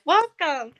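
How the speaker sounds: hologram greeting. Acted. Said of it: "it seems to say".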